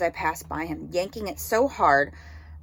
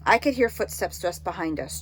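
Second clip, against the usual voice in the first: usual speaking voice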